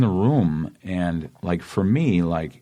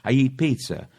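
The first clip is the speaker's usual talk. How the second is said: higher-pitched Boston local; Boston accent; 8 semitones higher than usual. Retold or imitated. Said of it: "That's what you're supposed to sound".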